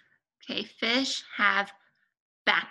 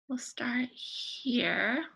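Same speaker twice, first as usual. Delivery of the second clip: whisper; 5 dB quieter, much slower than usual. Acted. Teacher whispers an aside.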